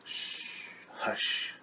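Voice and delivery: long soft shush, then hush; whispered hush. Acted. Mother hushing animals near sleeping baby, whispered.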